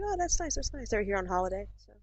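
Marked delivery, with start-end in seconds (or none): 0.0-0.9 high-pitched, polite small-talk gushing; politely impressed. Retold or imitated. Cashier retelling small talk with American visitors, politely impressed. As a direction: coo approval at a customer's news.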